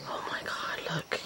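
a breathless whispered oh my god; awed whisper. Real, not acted. Staring at the coconut filling, an awed whisper. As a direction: whisper it in awe.

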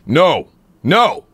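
mild angry no to the dog; deep angry dog scolding. Shown as a demonstration. Demo of scolding a dog in chest voice. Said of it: "you're kind of gonna drop into this".

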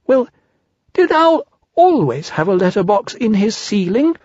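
high little voice, wondering; Piglet puzzled. Acted. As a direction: ask as a puzzled small animal.

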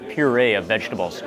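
usual speaking voice

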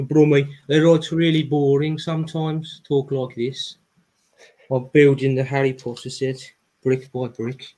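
drawn-out Brummie imitation; Birmingham accent. Shown as a demonstration. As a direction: show off a Brummie accent, dragging the vowels. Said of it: "I do a good Birmingham accent as well".

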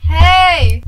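loud, high greeting yell. Real, not acted. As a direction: call out brightly. Calling out to sister.

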